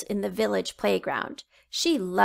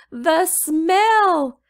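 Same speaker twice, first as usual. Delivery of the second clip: put-on louder, higher desperate child cry; choking little boy; 7 semitones higher, 8 dB louder than usual. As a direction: wail it as he sinks down. Little boy falling to knees.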